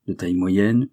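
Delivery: plain reading voice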